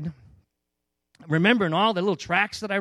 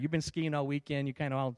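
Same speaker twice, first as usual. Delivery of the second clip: ordinary delivery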